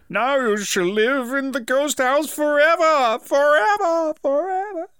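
high, slow ghostly wail rising; rising spooky ghost curse. Retold or imitated. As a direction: drag out forever like a haunting. Ghost parent's spooky pronouncement.

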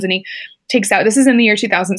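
normal talking voice

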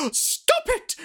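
put-on very high, squeaky old lady cry. Missus Scholes tells the kettle to stop, very high old lady voice. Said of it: "that's just my old lady voice".

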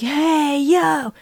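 mock the guy trying to chat her up; club guy greeting. Woman mimics a club guy's pickup line, mock bro greeting. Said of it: "is like".

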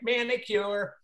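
1 calling out. Retold or imitated. Beautician calls back to shop.

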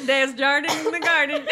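high, loud laughing words; laughing repetition. Real, not acted. Echoing co-host's silly joke, laughing loudly. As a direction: repeat it loudly while cracking up. Repeats joke while cracking up.

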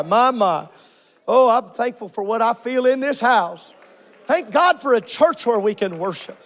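ordinary talk, nothing marked

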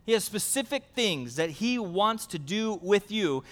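ordinary delivery

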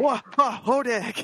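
hammed-up newsreel gasp; mock monster announcer.

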